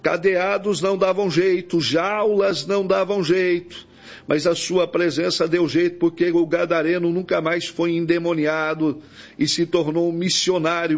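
ordinary talk, nothing marked

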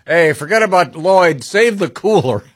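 high, careless yelled aside; buddies' voice. Pretend. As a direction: toss it off like an uncaring buddy.

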